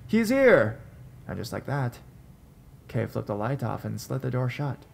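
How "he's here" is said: loud excited call; distant shout. Retold or imitated. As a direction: shout it across the house.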